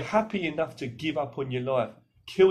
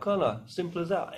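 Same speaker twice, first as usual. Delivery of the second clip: usual speaking voice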